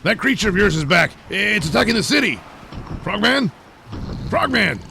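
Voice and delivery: frantic high shouting for Frogman; calling out. Acted. Night Night shouting for Frogman that the monster is back, frantic yelling.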